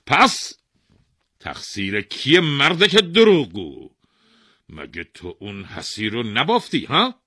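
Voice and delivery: loud, high scornful interrogation; furious king calling the weaver a liar; angry accusation. Acted. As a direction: shout down the liar.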